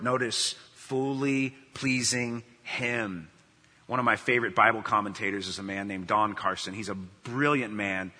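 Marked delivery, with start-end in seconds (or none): 0.7-3.3 "fully pleasing him" stretched out word by word; slow emphasis. Real, not acted. Preacher telling listeners to notice the verse, "fully pleasing him" very slow and emphatic.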